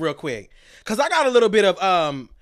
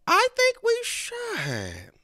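slow, high, thin put-on voice; silly high voice; 13 semitones higher, 5 dB quieter, much slower than usual. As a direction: answer yourself in a slow silly voice. Mock voice answering herself.

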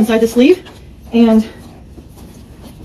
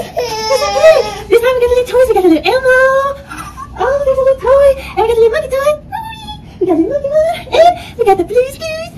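put-on excited baby talk; 13 semitones higher than usual. Vlogger presenting baby toys in a high, excited voice. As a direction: show off the toys like a children's host.

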